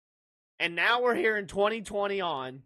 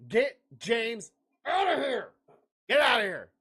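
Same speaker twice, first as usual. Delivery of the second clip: shouted get out; 4 semitones higher than usual. Real, not acted. Kicking out chatter James.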